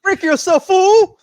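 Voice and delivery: huge high yell of a quoted line; shouted movie quote. Retold or imitated. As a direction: bellow it like the villain.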